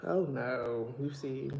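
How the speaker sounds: usual speaking voice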